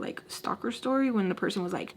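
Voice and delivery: ordinary talk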